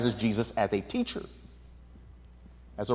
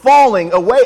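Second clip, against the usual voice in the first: bold Peter; 3 semitones higher, 7 dB louder than usual. Retold or imitated. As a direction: play Peter boasting to his Lord. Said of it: "You can almost hear Peter saying".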